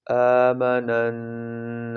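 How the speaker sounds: آمنن with the noon stretched through the nose, very slow; drawn-out nasal آمنن; long ghunnah. Shown as a demonstration. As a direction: hum the doubled noon long. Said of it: "ناک میں آواز کو چھپانے کو".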